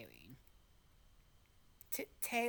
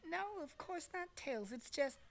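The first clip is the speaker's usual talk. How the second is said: nervous; 9 semitones higher, 7 dB quieter than usual. Acted. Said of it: "said nervously".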